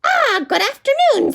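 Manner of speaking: high, scheming witch voice. Acted. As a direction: sweeten it like a sly witch.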